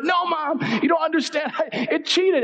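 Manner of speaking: hammed-up high-pitched childish protest; protesting kid. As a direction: plead like a kid in trouble. Child protests to scolding mother.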